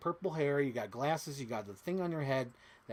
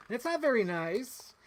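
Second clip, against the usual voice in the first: high, slow singsong reproach; mock-scolding catchphrase; 8 semitones higher, 3 dB louder, slower than usual. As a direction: draw it out high, lifting the end.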